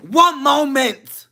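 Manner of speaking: slow, drawn-out high polite voice; slow prim voice. Retold or imitated. To the coworker, a slow drawn-out pointed politeness. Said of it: "I said".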